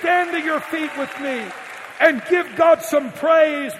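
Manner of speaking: rally the church to its feet; full-force preaching. Acted. Preacher's climax call to praise.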